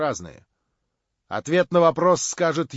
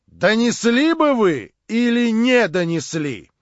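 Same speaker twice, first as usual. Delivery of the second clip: loud, slow, raised interrogation; pressing the question; 7 semitones higher, 9 dB louder, slower than usual. A narrator's reading. Verkhovensky keeps questioning the major, loud and slow. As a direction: press the question hard, word by word. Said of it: "продолжал Верховенский".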